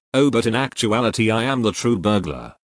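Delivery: ordinary talk, nothing marked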